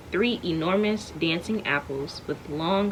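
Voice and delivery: usual speaking voice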